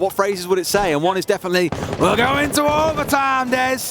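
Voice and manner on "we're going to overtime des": higher, slow hammed-up caster call; imitating his co-caster's catchphrase badly; mock catchphrase impression.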